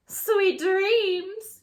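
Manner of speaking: high, loud cackling witch. A narrator's reading. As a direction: cackle the goodnight. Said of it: "she cackles".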